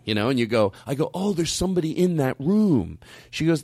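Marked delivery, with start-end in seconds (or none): 1.1-3.0 higher, falsely easygoing report; hiding annoyance. Retold or imitated. Guest reporting to the new clerk, hiding annoyance. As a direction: cover irritation with a polite, lifted voice. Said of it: "you hide everything".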